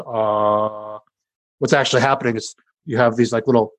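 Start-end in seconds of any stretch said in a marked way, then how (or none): 0.0-1.0 sustained ah. Shown as a demonstration. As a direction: hold a steady open ah. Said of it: "when somebody says".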